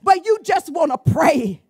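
ordinary talk, nothing marked

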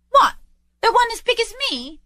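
very high, astonished lad; boy's voice. Acted. Boy asks Scrooge about the turkey, amazed. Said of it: "returned the boy".

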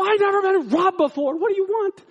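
high, loud comic protest; hammed-up exasperated holler; flustered retort. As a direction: shout back at the robber in a fluster, acted. Flustered retort to robber, retold.

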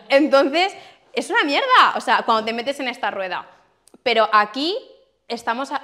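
usual speaking voice

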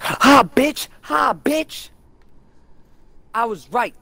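high, loud taunting crow; hammed-up triumphant taunt; mock gloating. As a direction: crow in exaggerated victory. Acting out gloating at coworker.

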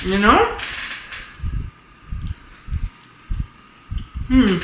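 chewing while talking; talking between bites; mouth full of pizza. Real, not acted. Seen 1: Holds pizza, bites at 1.8s, chews with full mouth throughout.

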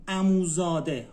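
usual speaking voice

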